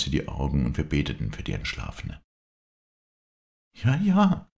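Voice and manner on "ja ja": high, loud exclamation; crying out. A narrator's reading. Viola insists on her sister's apparition, crying out. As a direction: call it out high and sudden. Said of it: "rief Viola".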